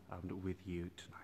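soft whispered aside; the priest murmurs to his online congregation, whispered. Real, not acted. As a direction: drop to a breathy hush before singing. Aside before starting the song.

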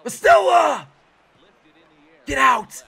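high, loud urgent yells; real burst of hope, shouted; excited shouting. Real, not acted. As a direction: yell the ball out of the park. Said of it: "I got a little excited".

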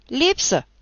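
shouted answer. Acted. A recruit answers the roll call, shouting. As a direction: call it out loud.

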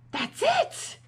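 high, loud cry of discovery; excited storybook exclamation; eureka. Acted. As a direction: burst out with the answer. Max finds the answer.

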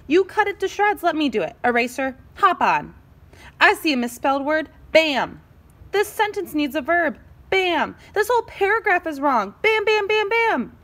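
high, rapid-building zeal; bossy, overeager. Acted. Stapler attacks the misspellings, overexcited. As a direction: build to a high volley of bams.

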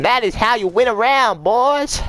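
high, loud victory cheer; triumphant yell. Real, not acted. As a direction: crow it to your teammates at full volume. Celebrating a round win.

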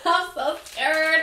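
high, slow nervous laugh; laughing scared. Real, not acted. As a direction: giggle through the fear. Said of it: "I'm kinda scared".

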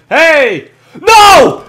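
very high, loud cry of alarm; panicked shout. Real, not acted. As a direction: yell in sudden panic. Nearly losing kill streak.

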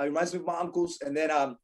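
normal talking voice

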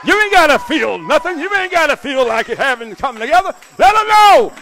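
loud, high, driving preacher's call; full-force preaching. Acted. Preacher urging husbands, at full force. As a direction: preach it hard to the congregation.

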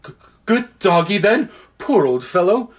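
shaky, gasped, stammering; gasping nervously. A narrator's reading. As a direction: gasp it out, frightened. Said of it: "held out his shaking hand and gasped".

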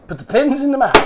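high, loud playful chant; high, loud and hammed-up; mock rallying call. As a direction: call it out like a game-show catchphrase. Mock crowd chant then echoed.

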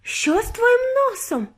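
high, drawn-out horrified question; alarmed shriek. A narrator's reading. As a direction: squeal it slowly in alarm. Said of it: "заверещала раптом пані Борогар".

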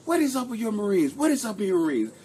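high-pitched acted demand for answers; exasperated commanding officer. CO looking at him about his Marines, exasperated. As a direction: imitate a boss calling someone to account. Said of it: "the C O's looking at me".

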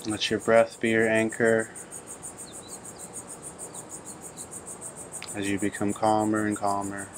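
calm, even meditation voice with long pauses; unhurried phrases, level in pitch, leaving long silences between them; guided meditation. Real, not acted. Teacher guiding listeners to breathe and turn within, calm meditation delivery.

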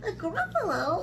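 high, lilting mouse question; surprised little mouse. Acted. Mouse feigning surprise at snake's ignorance, high and lilting. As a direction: echo it back in a high mouse voice.

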